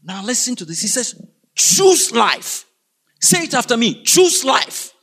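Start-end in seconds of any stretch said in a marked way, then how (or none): 1.5-2.6 punchy preacher's proclamation; emphatic command. Real, not acted. Preacher gives congregation God's command, emphatic. 4.0-4.9 high, ringing call to repeat; emphatic call. Real, not acted. Preacher has congregation say it after him, emphatic high call.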